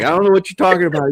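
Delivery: high, fast, loud denial; evasive bear. Acted. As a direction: play the bear rushing to deny it. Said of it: "And bear's like".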